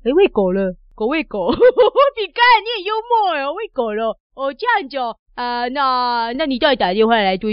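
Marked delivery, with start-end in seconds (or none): 1.6-2.1 突然爆出的响亮笑声; 被逗乐后忍不住哈哈大笑; 高声大笑. Real, not acted. 听到饼干喂狗的笑话. (响亮的开怀大笑)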